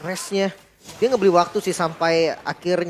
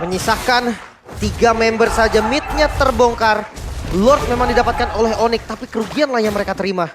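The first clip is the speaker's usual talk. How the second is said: loud hyped play-by-play; louder, punchy caster call; esports caster; 6 semitones higher, 7 dB louder than usual. Acted. Caster calls the fight.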